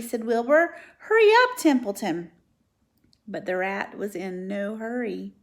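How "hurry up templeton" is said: very high, loud cry; urgent plea. Acted. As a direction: urge him on desperately.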